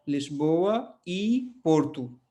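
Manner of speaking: लिस्बोआ ई पोर्टू said slowly, ई as a clear ee; Portuguese phrase demo. Shown as a demonstration. Teacher modelling Lisboa e Porto for students, slowly. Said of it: "जैसे कि आपको Lisbon और पोर्टू कहना है".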